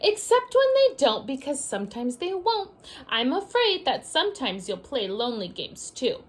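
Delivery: lively picture-book read-aloud; sing-song storybook reading; reading to children. A narrator's reading.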